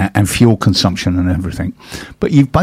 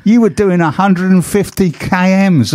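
higher put-on Cockney cop voice; mock Cockney accent; 9 semitones higher than usual. Police officer telling the speeder his speed, mock Cockney. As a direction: deliver the speed as a chirpy Cockney bobby. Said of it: "I don't think they said it in that accent".

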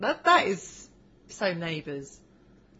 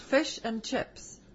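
slow, higher mock New Zealand vowels on Fish and chips; teasing a guest with a New Zealand accent; 4 semitones higher, 4 dB quieter, much slower than usual. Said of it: "Don't do the accent".

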